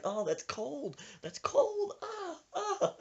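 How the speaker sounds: mock wail climbing into falsetto; yelping victim. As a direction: cry out as if doused in ice. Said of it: "He's like".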